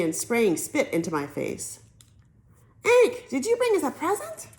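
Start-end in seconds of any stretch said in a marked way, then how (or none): 2.8-4.5 put-on high, pushy kid's question; eager little boys. A narrator's reading. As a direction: ask like a greedy excited kid. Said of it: "jumping on me".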